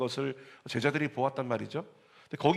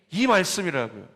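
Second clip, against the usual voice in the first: ordinary talk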